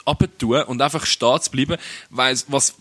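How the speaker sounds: usual speaking voice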